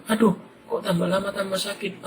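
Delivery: plain voiced child in pain; whining kid. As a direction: whimper it like a hurt little boy. Boy stung, head hurting.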